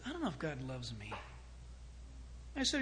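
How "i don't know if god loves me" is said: small, low, very soft retold admission; dejected confession. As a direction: imitate a downcast kid, hushed and quick. Boy confesses doubt to preacher.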